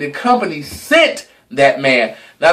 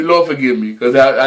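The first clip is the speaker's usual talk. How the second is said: ordinary talk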